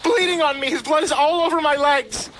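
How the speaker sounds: high, loud screech. A narrator's reading. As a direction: shriek it back in outrage. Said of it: "The actor screeched back".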